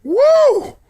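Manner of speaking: high excited woo; delighted hoot. Real, not acted. Hooting at the joke.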